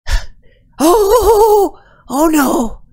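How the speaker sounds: high, loud panicked little "oh no"; frightened squeak. Acted. GM voicing the tiny scared ratling, high panicked squeak. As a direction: squeak it out in terror. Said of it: "and she says".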